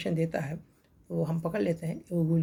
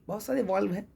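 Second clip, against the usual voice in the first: usual speaking voice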